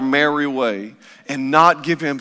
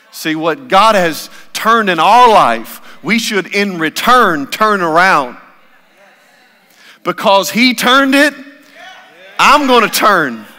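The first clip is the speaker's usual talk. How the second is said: louder, higher, rising sermon push; preaching at full force; 10 semitones higher, 11 dB louder than usual. Acted. Preacher urging the church to turn back, full-force preaching. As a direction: drive it home like a revival preacher.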